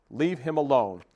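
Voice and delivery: loud, fierce command; angry shout. Acted. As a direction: yell at the fish to let go. Goose attacks the fish.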